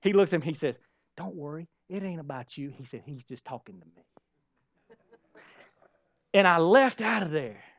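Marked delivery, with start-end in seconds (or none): 1.2-4.0 quiet reassurance. Retold or imitated. Quarterback reassures boy quietly.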